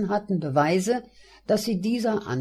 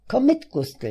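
brisk, higher and louder summons; calling out; 8 semitones higher, 5 dB louder than usual. A narrator's reading. Friend urging the narrator along, calling out. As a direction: call a friend along, pitched up.

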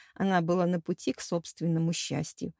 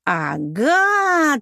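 loud, high, swelling exclamation; triumphant roar; 5 semitones higher, 6 dB louder than usual. A narrator's reading. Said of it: "торжествующе взревела Пенелопа". (торжествующий рёв)

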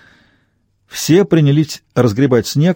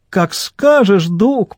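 high-pitched mimicry of a woman; sing-song mocking falsetto; mocking squeak; 7 semitones higher, much slower than usual. A narrator's reading. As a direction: squeak it to tease her. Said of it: "пропищал Арло, передразнивая Элейн".

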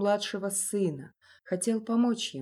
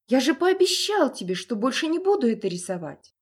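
high, loud panicked plea; beg, near tears; frightened pleading; 5 semitones higher, 6 dB louder than usual. Acted.

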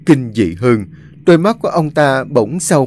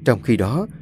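plain reading voice

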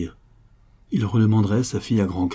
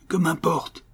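desperate count; 9 semitones higher, slower than usual. A narrator's reading. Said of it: "en se débattant".

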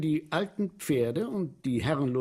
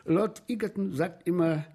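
ordinary talk

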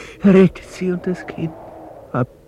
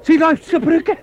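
high urgent cries pointing her out; desperate high calls to Meg; frantic calling; 10 semitones higher than usual. Acted. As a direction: shout in fear for her.

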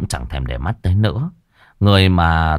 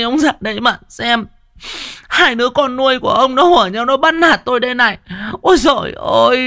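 wailing stepmother. Acted. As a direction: howl to the husband like a victim. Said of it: "nó cứ ngoạc mồm ra gào".

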